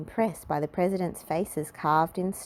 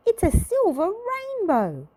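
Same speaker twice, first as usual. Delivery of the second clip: put-on very high airy girl voice; a ditzy girl marvelling at the arch; ditzy delight; 4 dB louder than usual. A narrator's reading. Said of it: "cried Little Miss Ditzy".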